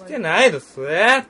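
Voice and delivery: mock Kyoto accent. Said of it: "バカにしてるやろ".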